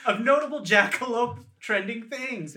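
high, giddy, laughing celebration; excited film voice. Pretend. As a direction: cheer like the film made it. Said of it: "low-budget mockumentary film".

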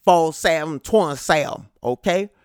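loud Southern twang on the score; Southern twang, boastful and loud. Shown as a demonstration. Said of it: "I had to put some Southern twang on".

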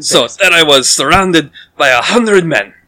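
loud boasting with a high peak; boastful storyteller. Acted. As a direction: brag dramatically to the crowd. Half-orc resumes boasting story.

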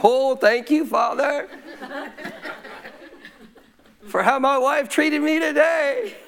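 high, drawn-out hammed-up gratitude; mock thankful prayer. Husband thanking God for how his wife treated him, mock-grateful. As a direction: overdo the thanks in a high put-on voice. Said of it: "My flesh wasn't saying".

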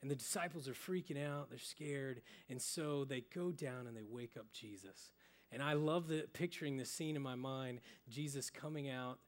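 normal talking voice

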